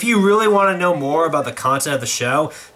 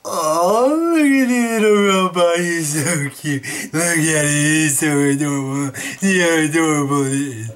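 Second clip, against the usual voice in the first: cooing. Acted. Cooing over cute robot.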